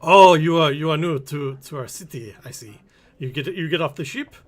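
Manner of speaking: put-on lilting Kuala Lumpur-style merchant accent; foreign merchant accent. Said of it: "I don't know why they have this accent".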